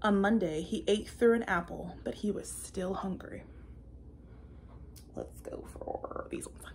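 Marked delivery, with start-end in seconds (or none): 2.0-3.4 soft, hushed whisper. Acted. Caterpillar still hungry after the apple. 5.1-6.6 low whispered aside; a quiet aside while choosing candy. Real, not acted. Aside while picking gummies.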